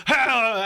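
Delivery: sudden loud, high mock yell; Odin's yell. Host imitating Odin cutting Loki off, a sudden yell. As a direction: bellow one wordless shout. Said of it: "Odin's yell at Loki is funny".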